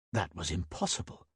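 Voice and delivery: usual speaking voice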